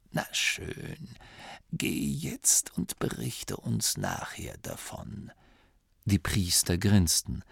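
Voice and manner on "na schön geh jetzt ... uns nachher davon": soft, slow, breathy dismissal; dismissive old abbot. Acted. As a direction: wave him off gently.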